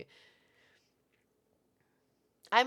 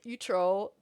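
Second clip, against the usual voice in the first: ordinary delivery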